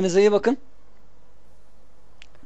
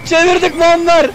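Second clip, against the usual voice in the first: excited cheering; 7 semitones higher, 4 dB louder, slower than usual. Real, not acted. Celebrating comeback goal.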